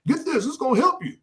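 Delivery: mock ad promise in a high voice; upbeat sales pitch. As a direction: sell it like a drug commercial, voice lifted. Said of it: "they promoting it to you".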